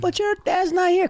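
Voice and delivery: put-on high voice, worried girlfriend; mock girlfriend. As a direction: girlfriend reminding her dad is away, high voice.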